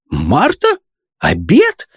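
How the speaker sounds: two stunned, separate questions, each rising; astonished questioning. A narrator's reading. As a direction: echo it back in disbelief. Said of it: "воскликнула поражённая мадам".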